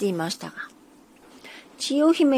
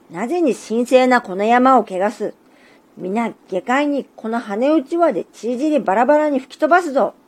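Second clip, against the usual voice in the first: big tengu roaring, louder and rising to the end; thunderous shout; 2 semitones higher, 5 dB louder than usual. Acted. Tallest tengu yells at intruders on his sacred mountain, thunderous shout. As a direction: bellow it down at the trespassers like thunder. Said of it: "まるで雷鳴のような大きな声で叫びました".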